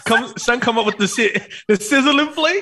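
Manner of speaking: high hammed-up calling out; mock chef call. Friend acting the chef beckoning a diner, hammed-up. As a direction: beckon him over, playing it up. Mimicking Salt Bae calling.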